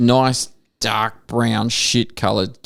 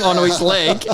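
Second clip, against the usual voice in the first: ordinary delivery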